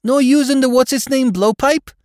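very high, loud New York dialect; mounting disbelief as a question; incredulous. A narrator's reading. Spike quizzing his retired boss, incredulous.

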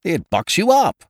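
loud, high, punchy assurance; hearty sales push. A narrator's reading. Ukridge pressing his tonic on the hostess, loud and hearty. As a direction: sell it with gusto.

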